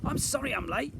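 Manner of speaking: high, loud called apology; calling out. A narrator's reading. Slime arriving late, high called-out apology. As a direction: call it out from afar. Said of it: "Slime called out".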